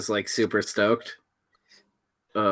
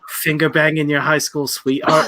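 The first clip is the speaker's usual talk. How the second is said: lightly put-on Australian accent, slightly raised; 4 semitones higher, 3 dB louder, faster than usual. Retelling his drunk foul talk, in a light Australian accent. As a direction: slip into a bit of Australian. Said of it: "couldn't not inflect it in the fucking Australian accent".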